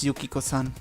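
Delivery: ordinary talk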